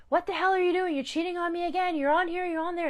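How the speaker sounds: high-pitched mock accusing rant; mock jealous accusation. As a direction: mimic the narcissist throwing wild accusations. Narcissist's accusation acted out.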